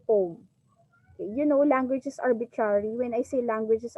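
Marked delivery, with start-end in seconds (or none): 0.1-0.4 Poem as one low, falling syllable, POHM; Poem said as "pome", no oy glide; corrected "pome" pronunciation. Shown as a demonstration. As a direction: say Poem to rhyme with home. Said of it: "but it's poem".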